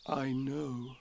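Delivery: soft, small humble answer; humbly. Acted. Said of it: "said Pooh humbly".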